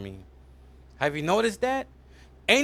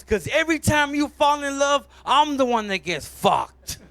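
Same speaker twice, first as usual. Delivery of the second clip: high, loud aggrieved complaint; indignant outburst; 8 semitones higher, 5 dB louder than usual. Acted. Asshole blames the heart for its suffering, indignant.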